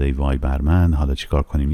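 usual speaking voice

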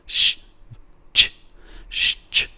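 "ش" and "چ" as two bare consonants; a hushed "ش", then a short "چ", no vowel; separate sh, ch. Shown as a demonstration. Teacher warning against two full letters, separate sh and ch. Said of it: "ش و چه نیستش".

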